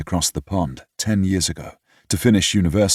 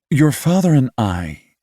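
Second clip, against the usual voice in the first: higher, slow, hesitant mother voice; hesitant start; 7 semitones higher, 4 dB louder, slower than usual. A narrator's reading. Mother beginning to announce the divorce, hesitant. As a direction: ease into bad news, faltering. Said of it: "began and then cleared her throat".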